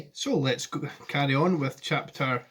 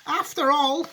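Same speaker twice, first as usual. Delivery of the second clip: put-on high, near-crying old man; overwhelmed with emotion; tearful Grandpa Joe; 12 semitones higher, 4 dB louder than usual. Grandpa Joe asking Wonka, high and near tears.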